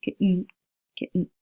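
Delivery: "kitten" as kit'n, T stopped in the throat; glottal stop. Shown as a demonstration. Teacher attempting a sound hard for her, "kitten" with glottal stop. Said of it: "you've got the glottal stop".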